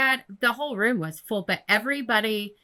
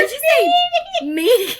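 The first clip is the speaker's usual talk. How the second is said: high, loud laughing shriek; real helpless laughter through the words; incredulous laughter; 5 dB louder, faster than usual. Real, not acted. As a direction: squeal the question while cracking up. Incredulous, cracking up over word.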